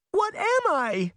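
high, slow, frightened cry. A narrator's reading. Daughter crying out to her mother, high slow frightened wail. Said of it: "Allison cried".

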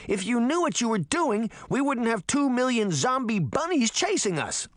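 high-pitched scolding retort; angry shout. A narrator's reading. Sabrina arguing with Puck mid-flight, shouting back. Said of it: "she shouted".

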